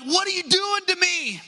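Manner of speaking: comic donkey protesting its beating; mock donkey voice. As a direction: play the wronged donkey with a comic high whine. Talking donkey quoted in story.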